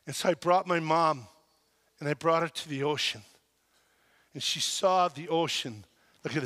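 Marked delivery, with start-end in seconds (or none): none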